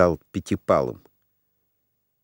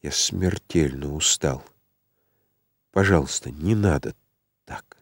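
low, soft, weary plea; exhausted hush, trailing away; quiet, tired; 5 semitones lower, 5 dB quieter than usual. A narrator's reading. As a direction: plead quietly, fading almost to nothing. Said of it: "сказал он тихо".

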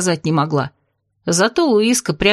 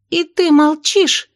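high, drawn-out indignant question; 9 semitones higher, slower than usual. A narrator's reading. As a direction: demand it in disbelief, pitch way up. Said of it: "в один голос возмутились подружки".

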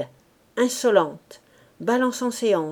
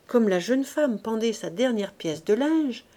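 plain reading voice

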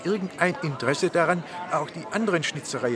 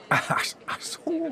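surprised realisation; 11 semitones higher, slower than usual. Real, not acted. Sudden realisation.

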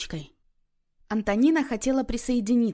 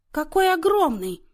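high-pitched horrified exclamation; appalled; 9 semitones higher, 4 dB louder than usual. A narrator's reading. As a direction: gasp it out, aghast at the size. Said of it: "ужаснулась матушка".

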